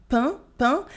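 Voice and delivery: "pain" with nasal eh, no sounded N; nasal vowel. Shown as a demonstration. Teacher demonstrating nasal sounds, "pain" on nasal eh. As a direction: say French "pain" through the nose, like pan without the N. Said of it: "the un in pain".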